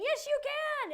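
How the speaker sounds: rousing yes, you can; cheering. Acted. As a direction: shout encouragement like a cheerleader. Cheering students on.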